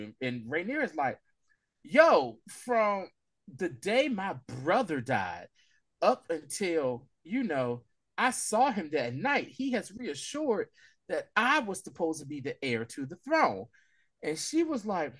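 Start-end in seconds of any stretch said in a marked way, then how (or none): 1.8-13.7 slow earnest claim. Retold or imitated.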